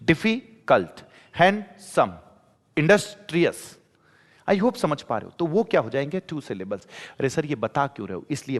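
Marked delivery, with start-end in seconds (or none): 0.0-1.0 difficult slowly split as "डिफी... cult"; pause mid-word. Shown as a demonstration. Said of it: "जैसे बीच में रुक रहे हो". 1.4-2.1 handsome split as "hand... सम", pitched up; handsome in two separate, higher-pitched beats; pause mid-word. Shown as a demonstration. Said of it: "जैसे बीच में रुक रहे हो". 2.8-3.8 industrious broken as "Indus... ट्रियस"; pause mid-word. Shown as a demonstration. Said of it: "जैसे बीच में रुक रहे हो".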